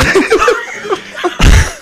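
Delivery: airy high-pitched giggle fit; unvoiced laugh, caught off guard; breathless high laugh. Real, not acted.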